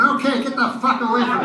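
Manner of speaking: high, loud, laughing brush-off; mock fed-up yell. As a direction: shove the dog off, half laughing. Fed up with licking dog.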